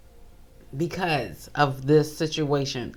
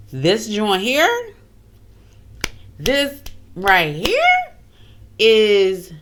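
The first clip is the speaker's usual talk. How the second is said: very slow and louder, stretching each word; drawn-out emphasis; 4 semitones higher, 5 dB louder, much slower than usual. Real, not acted. Host reacting to a haunted dollhouse, slow and emphatic. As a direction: drag it out for dramatic effect.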